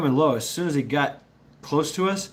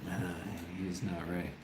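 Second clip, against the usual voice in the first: ordinary talk, nothing marked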